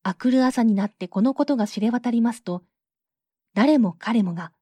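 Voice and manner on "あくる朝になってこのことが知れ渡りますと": plain reading voice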